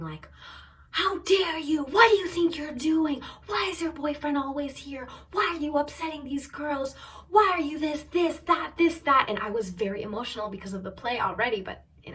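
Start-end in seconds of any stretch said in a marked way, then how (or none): 0.9-9.3 high, loud retold tirade; mock screaming. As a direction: imitate a screaming, outraged mother. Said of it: "she starts screaming, like, really screaming".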